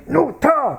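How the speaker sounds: high, abrupt mimicked order; imitated rude demand. Director mimicking Korean students to staff, rude demand. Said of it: "because of the tone".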